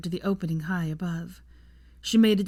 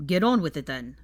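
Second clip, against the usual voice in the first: brusque order; 2 semitones lower, 3 dB louder than usual. A narrator's reading. Team leader dismisses his soldier with order.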